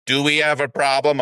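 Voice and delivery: mock echo of a tough guy. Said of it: "And I go".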